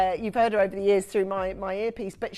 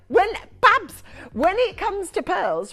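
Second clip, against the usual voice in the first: much higher and louder, slow and emphatic; imitating Rach; 11 semitones higher, 8 dB louder, slower than usual. Host quoting Rach on pearls, high, loud and emphatic. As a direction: lean hard on it like a bossy best mate. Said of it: "You can hear her".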